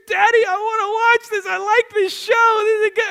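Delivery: imitated high, loud child's wail; hammed-up tantrum of a four-year-old; crying little boy. Small son begs daddy to watch his show, wailing. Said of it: "He starts crying".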